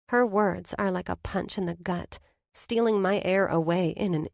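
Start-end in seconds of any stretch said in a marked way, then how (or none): none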